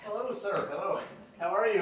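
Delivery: normal talking voice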